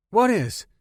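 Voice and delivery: high, loud startled ask; startled question. Acted. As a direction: snap round in surprise. Startled reply.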